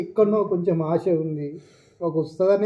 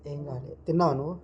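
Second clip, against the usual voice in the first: tearful quiet deflection; 4 semitones lower, 6 dB quieter than usual. Acted. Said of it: "ఎందుకు ఏడుస్తున్నావు?".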